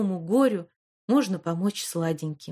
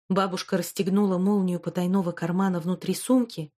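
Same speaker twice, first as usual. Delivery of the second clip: ordinary delivery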